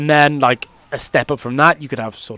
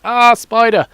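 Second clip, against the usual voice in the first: mock panic; 6 semitones higher, slower than usual. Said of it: "they just panic and they're like".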